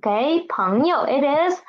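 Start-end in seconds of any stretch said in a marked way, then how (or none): none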